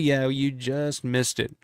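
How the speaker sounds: normal talking voice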